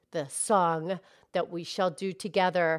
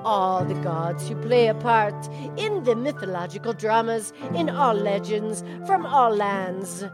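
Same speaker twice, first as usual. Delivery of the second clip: put-on Scottish accent recitation; 5 semitones lower, 4 dB quieter, faster than usual. Cow recites [name]'s Atlantis in a mild Scottish accent. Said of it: "You do it again, please".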